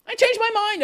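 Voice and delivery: "I changed my mind" jumped up high in a prissy sing-song; high mocking take; mock whiny intern. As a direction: repeat it like a spoiled brat. Intern quote, repeated mockingly.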